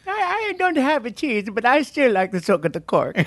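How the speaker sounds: high, slowed mock-female sketch voice; woman's voice. Sketch woman joining the cork soakers' joke, mock-female high voice. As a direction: put on a high-pitched woman's character. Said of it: "the women come in, like".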